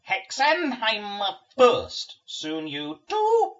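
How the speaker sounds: slow, loud, high buzzing command; buzzing demon voice. Acted. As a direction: drone the order like a swarm queen. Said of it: "Matron gloats at me".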